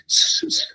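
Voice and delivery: a long hissing s, unvoiced; hissed s sounds. Shown as a demonstration. Teacher hissing s to show seseo. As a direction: hiss the s like a snake. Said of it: "usamos mucho s".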